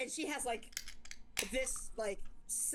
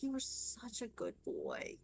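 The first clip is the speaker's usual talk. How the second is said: tender mom; 4 dB quieter, slower than usual. Acted.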